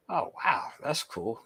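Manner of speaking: a soft whispered "oh, wow". Real, not acted. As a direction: whisper it like a secret surprise. Reacting to chat comment.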